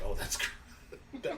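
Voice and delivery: breaking into laughter on the words; high, drawn-out words dissolving into laughter; laughing. Real, not acted. Cracks up at own story.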